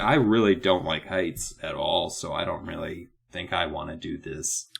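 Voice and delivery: low, softening timid mumble; subdued, hesitant low voice; reluctant kid. Acted. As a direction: back out quietly, trailing off. Reluctant child voiced in a story.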